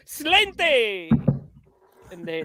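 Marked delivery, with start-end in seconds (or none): 0.1-1.1 mock Spanish barman. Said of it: "and then they'd go".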